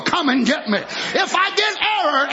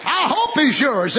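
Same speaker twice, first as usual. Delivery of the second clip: ordinary talk, nothing marked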